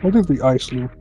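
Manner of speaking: ordinary talk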